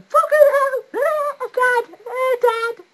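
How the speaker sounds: high, loud panicked yells for dad; a kid running home bleeding, calling dad; hysterical shouting. Retold or imitated. A bleeding boy running home calling dad, hysterical yells. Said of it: "all hysterical".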